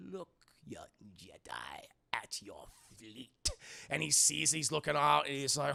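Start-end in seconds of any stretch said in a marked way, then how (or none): 0.1-3.5 low, slow sinister whisper; tempting devilish whisper. Acted. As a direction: whisper it like an evil emperor. Palpatine taunts Luke.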